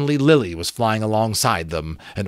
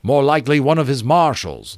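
mild shout; 5 semitones higher, 5 dB louder than usual. A narrator's reading. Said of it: "Lawrence shouted over the wind".

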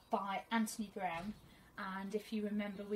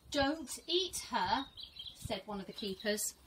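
teacher reading to her class, gentle and expressive; storybook reading; 5 dB louder than usual. Acted. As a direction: read it like a teacher sharing a picture book with her class. Reads picture book to pupils.

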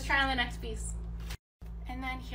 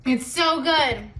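drawn-out loud rave, mouth full; loud and slow; 3 semitones higher, 11 dB louder than usual. Real, not acted. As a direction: belt it out slowly. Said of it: "Oh my God, I screamed".

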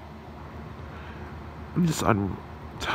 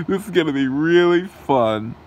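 raised, gleeful sidekick villain voice; 4 semitones higher than usual. Acted. Sidekick villain tells the boss the trap will be fun, gleeful. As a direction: relish the coming fun.

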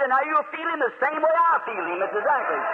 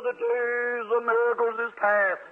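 mocking the doubters; 3 semitones lower, slower than usual. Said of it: "they say".

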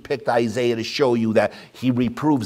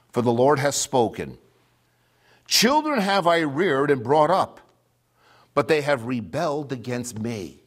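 raised, ringing scripture reading; lifted pulpit reading voice; preaching scripture; 4 semitones higher than usual. Acted. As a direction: proclaim the verses like a prophet. Reads Isaiah with preaching force.

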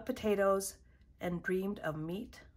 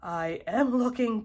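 louder, astonished gulp; 3 semitones higher, 6 dB louder than usual. A narrator's reading. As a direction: gulp it out in disbelief. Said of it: "gulped her husband".

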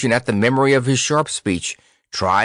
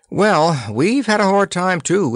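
high-pitched admission; conceding prince; 10 semitones higher than usual. A narrator's reading. Tatters admitting his own hardship, conceding. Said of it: "admitted the Prince of Ragbad".

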